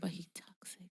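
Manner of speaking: hushed aside. Real, not acted. Adding a jab about Carl, hushed whispered aside. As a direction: slip it in under your breath.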